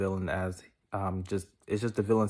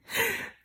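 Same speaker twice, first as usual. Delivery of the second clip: high squeal of a laugh; 3 dB quieter than usual. Real, not acted. Laughs at own joke.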